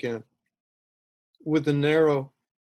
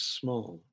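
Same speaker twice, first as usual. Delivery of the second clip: ordinary delivery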